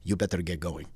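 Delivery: deadpan mock Greek accent; heavy Greek accent. Instructor hurrying his student off the cliff, heavy Greek accent. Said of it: "in a very heavy accent".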